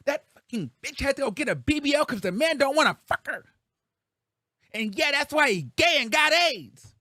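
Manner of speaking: mock Tasha K spitting venom at the top of her voice; 1 mock furious rant. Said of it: "Tasha K".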